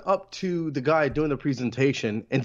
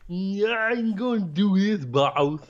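drawn-out, higher dopey crook voice; dumb henchman voice; 4 semitones higher, slower than usual. Acted. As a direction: put on a silly cartoon villain voice. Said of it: "I wish he would have had that voice".

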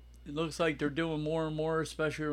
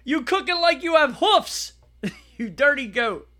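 much higher, louder mock rant breaking into laughter; hammed-up chef insult, laughing through it; Gordon Ramsay impression; 9 semitones higher, 8 dB louder than usual. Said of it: "Gordon Ramsay".

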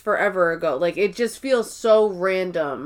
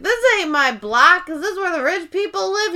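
put-on drunk Meredith; 9 semitones higher than usual. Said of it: "I love how she's like".

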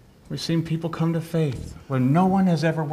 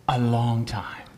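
hushed whisper stretching a long time; 5 semitones lower, slower than usual. Acted. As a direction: breathe a long time out slowly, barely voiced. Repeats phrase, now whispered.

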